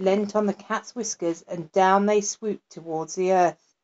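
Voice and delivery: usual speaking voice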